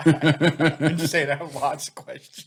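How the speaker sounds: slow, giggling through the words; laughing. Real, not acted. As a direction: laugh while insisting. Laughing defends her questioning.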